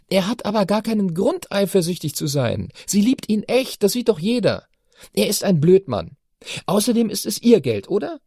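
put-on high, insistent girl's voice; teenage daughter, indignant. A narrator's reading. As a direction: argue like a righteous teen. Chiara argues passionately.

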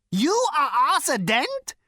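very high, loud, rising squeals; astonished delight. Acted. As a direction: squeal in disbelief. Prak recognises Arthur, delighted.

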